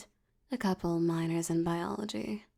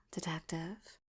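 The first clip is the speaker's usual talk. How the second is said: much softer, a breathy whispered detective; seductive whisper; 3 semitones lower, 9 dB quieter than usual. Acted. Mob boss whispering a come-on to the detective. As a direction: breathe the word into the ear.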